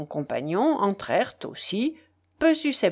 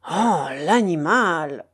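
slow, drawn-out exasperated rebuke; exasperated telling-off; scolding; 3 semitones lower, 3 dB louder, slower than usual. A narrator's reading. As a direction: scold with a long, weary groan of disapproval. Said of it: "morigéna l'autre".

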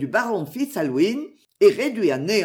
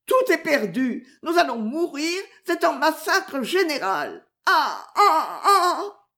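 high-pitched wail breaking into sobs; sobbing cry; 7 semitones higher than usual. A narrator's reading. Maud announces a general massacre, sobbing. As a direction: cry out in hammed-up despair. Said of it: "s'écria Maud avec des sanglots".

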